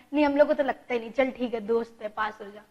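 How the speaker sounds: low, soft offhand aside; understated dismissive student reply; mock student indifference. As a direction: shrug it off quietly as a student, trailing away.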